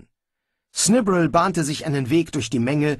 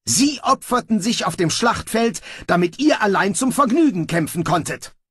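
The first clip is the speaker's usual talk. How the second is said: high, loud furious reproach; trembling with rage; 9 semitones higher, 3 dB louder than usual. Acted. Snibral shaming the brawlers, shaking with rage. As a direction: shake with anger. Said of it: "Snibral bebte vor Zorn".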